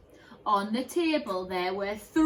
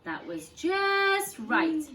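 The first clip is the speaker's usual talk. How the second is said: satisfied; 7 semitones higher, 4 dB louder, much slower than usual. Acted. Goldilocks pleased with porridge.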